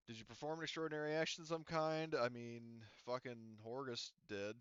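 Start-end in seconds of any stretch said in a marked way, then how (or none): none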